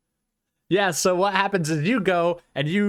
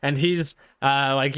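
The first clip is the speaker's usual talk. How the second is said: normal talking voice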